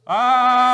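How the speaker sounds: long, high, full-volume "Ah!"; loud group shout. Pretend. Volunteers reenacting the people shouting at Jericho's walls, loud shout. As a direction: everyone shouts as loudly as possible, held long. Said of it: "Everyone shout loudly".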